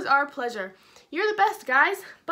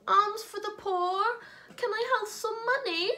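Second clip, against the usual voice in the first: paralyzed beggar; 8 semitones higher than usual. Acted. Paralyzed man begging passersby for money, high pleading voice. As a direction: beg like a poor man on the street. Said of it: "our paralyzed man".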